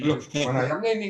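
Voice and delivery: quick, high, startled cry of a wife; imitating a puzzled wife looking for the chicken; surprised wife. Wife finds the chicken gone.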